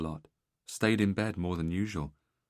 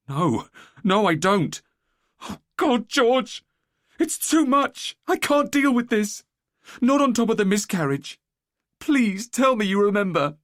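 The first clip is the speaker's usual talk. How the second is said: much higher and louder, breaking down; distraught crying; 11 dB louder, slower than usual. Acted. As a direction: sob out the plea in anguish. Wife breaking down sobbing.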